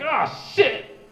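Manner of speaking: high, loud startled yelp; alarmed realization. Retold or imitated. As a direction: caught out, panicked. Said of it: "And he's like".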